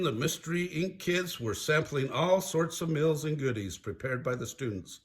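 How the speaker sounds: ordinary delivery, nothing marked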